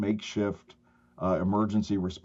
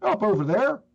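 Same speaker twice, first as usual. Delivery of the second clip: high, louder imitation of a Southern boy; Southern-accented child's voice, pitched up; little Southern accent; 12 semitones higher, 5 dB louder than usual. Little boy points responder to Granny, high Southern accent. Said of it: "this little Southern accent".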